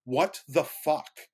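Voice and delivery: loud, high, drawn-out disbelief; slow it down and punch each word in retold outrage; outraged. Teller reacting to coworker telling his boss, outraged.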